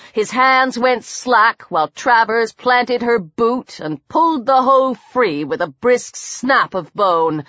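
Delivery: usual speaking voice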